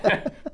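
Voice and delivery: a quick stunned laugh; short disbelieving laugh. Real, not acted. Laughs at the absurd bill.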